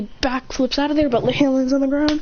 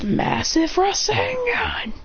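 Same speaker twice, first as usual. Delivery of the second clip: high, very slow rasengan; high stretched anime call; slow attack call; 10 semitones higher, much slower than usual. Acted. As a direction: drag out the big move. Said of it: "he says".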